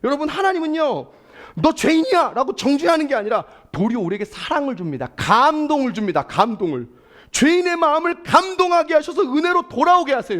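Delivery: high, loud, full-force sermon delivery; fiery preaching. Acted. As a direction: rouse the congregation from the pulpit. Preaches to the congregation.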